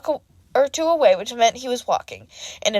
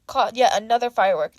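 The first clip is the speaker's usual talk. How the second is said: ordinary delivery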